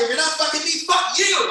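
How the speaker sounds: high, loud comic shout; full-voiced mock fury; shouted outrage. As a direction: yell it as an indignant kiss-off. Retold angry outburst before storming out.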